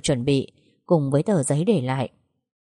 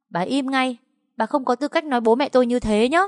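much higher, sharp shout back; angry retort; 8 semitones higher than usual. Acted. Young woman ordering the old mother to shut up, angry. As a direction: cut her off angrily.

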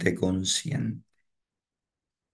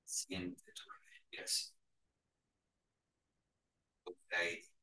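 whispered meditation cue; 20 dB quieter than usual. Acted. Guide whispering to the class to feel their breath.

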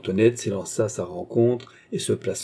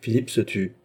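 plain reading voice

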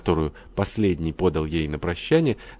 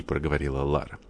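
plain reading voice

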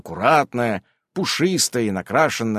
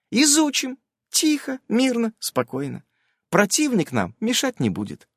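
high, reassuring female character; high-voiced magic companion; 10 semitones higher than usual. A narrator's reading. Nasa reassures the player, in a high character voice. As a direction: pitch it well up, soothing.

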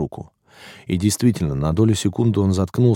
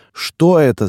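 high, loud startled squeal; 9 semitones higher, 6 dB louder, slower than usual. A narrator's reading. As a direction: squeal the question in alarm. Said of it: "завизжал полный".

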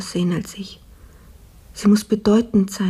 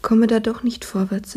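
weak sick whisper. Acted. Sick maid, weak in bed.

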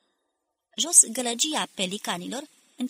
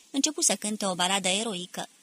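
plain reading voice

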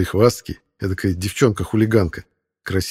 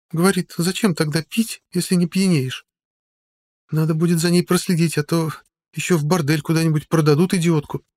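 worried woman; 10 semitones higher than usual. A narrator's reading. Sodia worried speech.

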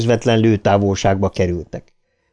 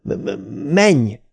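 halting, stuttered command; stuttered urgent order; slower than usual. A narrator's reading. Bill urges Ben on after hitting his back, stuttered urging. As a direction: stammer on the first sound, then push the word out.

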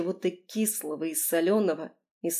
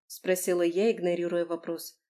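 usual speaking voice

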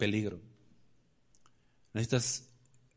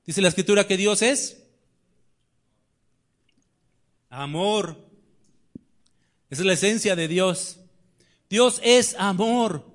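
raised, ringing pulpit voice; fiery preaching; 4 semitones higher, 5 dB louder than usual. Acted. Preacher challenging the congregation, raised and ringing.

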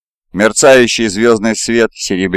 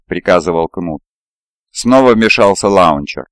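ordinary delivery

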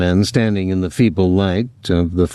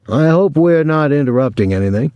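churchly; 5 semitones higher than usual. A narrator's reading. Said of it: "his voice more ceremoniously churchly".

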